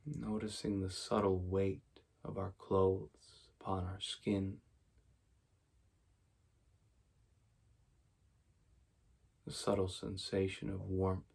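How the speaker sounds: soft, even meditation voice with long pauses; quiet, unhurried mindfulness guidance; guided meditation. Real, not acted. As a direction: guide them gently into stillness, leaving space after each phrase.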